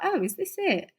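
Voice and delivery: retold underwhelmed reaction. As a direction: pitch it up, mildly let down. Underwhelmed on walking into studio.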